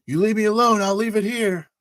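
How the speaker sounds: high, loud bargaining yell; shouted ultimatum. Acted. Pilot offering package for safety, shouted ultimatum. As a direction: shout the deal across. Player shouts deal to enemy.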